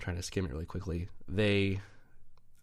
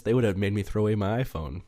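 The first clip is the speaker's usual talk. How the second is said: ordinary delivery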